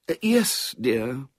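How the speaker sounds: baffled mother humouring a guest; mystified murmur. A narrator's reading. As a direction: agree without understanding a word.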